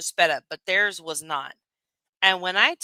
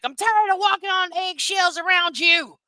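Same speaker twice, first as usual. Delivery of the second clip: high, louder mock of a tearful rant; mocking imitation; 10 semitones higher, 4 dB louder than usual. Streamer mocking another streamer's rant, imitating high and loud. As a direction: parody her outburst in a higher, louder voice. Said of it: "and you're like".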